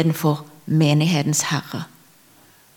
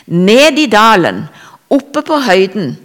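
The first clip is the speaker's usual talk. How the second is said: emphatic preaching; 3 semitones higher, 7 dB louder than usual. Real, not acted. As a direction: call out each phrase with preacher's force. Preaching climax, valley and height.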